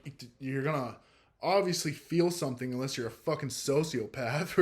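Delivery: ordinary talk, nothing marked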